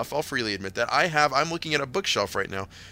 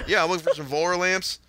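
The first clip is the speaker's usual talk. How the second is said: higher-pitched Southern customer; Southern accent; 6 semitones higher than usual. Retold or imitated. Customer repeats his request to the worker, Southern accent. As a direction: mimic a Southern shopper's mushy vowels. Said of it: "I couldn't understand him".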